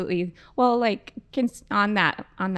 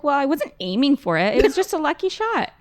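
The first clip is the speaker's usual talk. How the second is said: lightly mimicked higher-pitched protest; retold excuse; 5 semitones higher, 3 dB louder than usual. Retelling a girl's excuse for kicking people, lightly imitated defensive protest. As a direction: imitate her excusing herself, pitch climbing.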